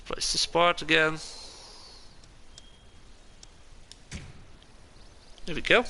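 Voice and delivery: nose whistling on each breath. Real, not acted. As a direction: air whistling through nose. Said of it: "My nose is whistling".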